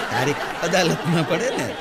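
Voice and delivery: ordinary talk, nothing marked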